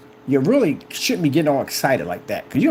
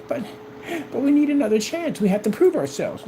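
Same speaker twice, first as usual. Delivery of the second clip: higher pleading mock voice; 10 semitones higher than usual. Rivals begging him for another chance, in mock pleading.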